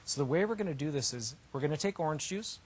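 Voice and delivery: usual speaking voice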